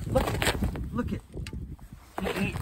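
whispered astonishment; breathless whisper. Real, not acted. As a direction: hush it out in amazement. Awed by the catch.

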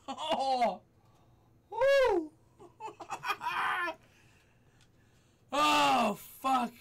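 high, loud gasps and swearing; real post-scare shock; rattled whooping. Real, not acted. Recovering from the jump scare.

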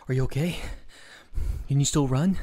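pant, then ask urgently through the breaths; acted out of breath. A narrator's reading. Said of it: "His breathing is fast".

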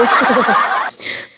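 laughing at own joke; real laugh. Real, not acted. Streamer joking to viewers about a lingering cough, real laugh.

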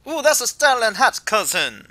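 loud, hammed-up Jamaican accent; attempted Jamaican accent. Kukui admires the player's hat, attempted Jamaican accent. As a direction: do a breezy bad Jamaican voice. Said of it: "I know I can't do Jamaican".

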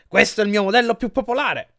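high, loud salesman's boast; big, enthusiastic showroom pitch; showy sales pitch. A narrator's reading. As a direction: proudly show off the prize item. Shop owner proudly showing a bed.

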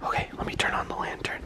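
nervous whisper then a gasp; breathy frightened whisper. Real, not acted. Alone in dark house, scared.

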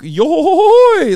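high shouted yelp of a laugh; Brook's laugh. Retold or imitated. Imitating Brook's laugh for viewers, high shouted yelp. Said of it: "for Brook".

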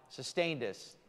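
normal talking voice

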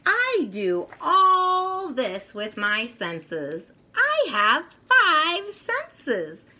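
lively picture-book read-aloud voice; reading to children. A narrator's reading. Reading the senses book aloud to young children, lively. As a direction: read it brightly to little kids.

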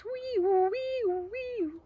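high wailing "wee" siren; imitated siren. Zoe playing fire engine, high siren wail. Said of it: "a loud siren that goes".

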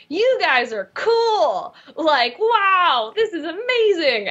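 high, gushing fan amazement, stretched out; starstruck awe. Retold or imitated. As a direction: gush like an awestruck newcomer. Acting out starstruck awe at colleagues.

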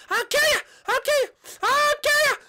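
high shouting that keeps building; enraged threats. Acted. Peach hunting down the fan, escalating rage.

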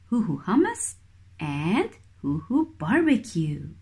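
ordinary talk, nothing marked

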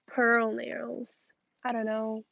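ordinary talk, nothing marked

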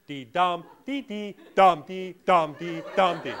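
loud "dum", lighter "dee"; metrical beat demo. Shown as a demonstration. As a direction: punch each "dum" and skim the "dee dee". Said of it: "An iamb, followed by the pyrrhic".

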